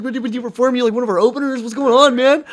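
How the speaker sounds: imitated high, loud volley of questions; retold excited questioning. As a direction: pester loudly as the puzzled singer. Retells singer's excited questioning.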